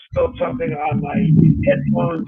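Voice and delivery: muffled underwater audio. Real, not acted. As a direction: sounds like speaking underwater. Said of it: "It sounds like you're underwater".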